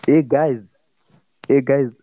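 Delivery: a hint of a Zimbabwean man greeting; Zimbabwean accent. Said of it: "I'm making a Zimbabwean accent".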